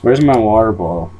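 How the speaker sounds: ordinary talk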